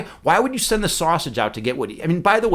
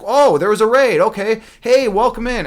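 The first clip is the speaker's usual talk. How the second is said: surprised welcome; 5 semitones higher, 5 dB louder than usual. Retold or imitated. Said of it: "I came back and I was like".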